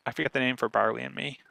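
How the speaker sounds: normal talking voice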